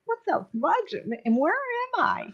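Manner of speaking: confused and startled, asking aloud; bewildered. Retold or imitated. Soul lands on conveyor belt.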